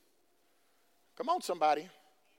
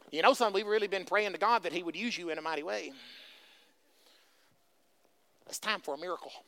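soft, low, understated coaxing; low-key, hushed motherly request; mother to son; 7 semitones lower, 7 dB quieter, faster than usual. Retold or imitated. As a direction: play a mother quietly nudging her son. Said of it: "Jamie style".

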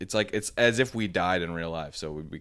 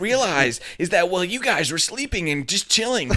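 high, loud heroic boast; 11 semitones higher, 7 dB louder than usual. Acted. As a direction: puff up like an anime hero. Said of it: "That was a good little hero voice".

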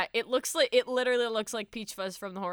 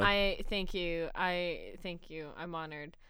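mock-solemn thanks; 6 semitones lower, 7 dB quieter than usual. Accepting praise for reporter voice.

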